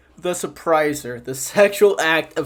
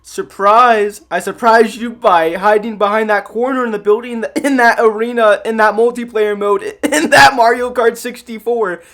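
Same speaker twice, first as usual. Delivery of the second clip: higher, louder mock mom; typical mom voice; 6 semitones higher, 5 dB louder than usual. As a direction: play a mom announcing her big surprise. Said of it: "typical mom voice".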